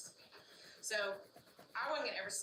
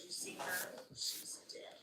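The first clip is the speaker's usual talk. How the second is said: husband's sleep-talk, dropping to a whisper; husband murmuring in his sleep, retold as an eerie whisper; much slower than usual. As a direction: let the last words fade to a breathless whisper. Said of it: "He goes".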